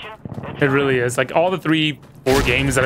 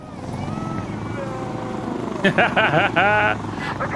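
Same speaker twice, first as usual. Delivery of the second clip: high burst of gleeful laughter; long gleeful laugh; 10 semitones higher, 3 dB quieter than usual. Real, not acted. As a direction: laugh hard at the kill. Laughing after a kill.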